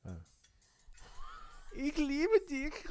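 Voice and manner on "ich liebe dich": slow, very high crooned Ich liebe dich; high falsetto German. Pretend. Teasing co-host, sings love line in falsetto.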